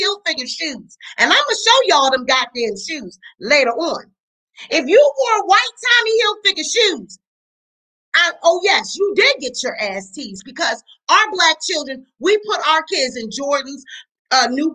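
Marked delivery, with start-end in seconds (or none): 4.7-10.1 heated outburst. Real, not acted. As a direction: shout it, worked up. Heated rant about teasing.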